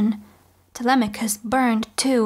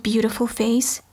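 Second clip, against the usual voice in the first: ordinary delivery, nothing marked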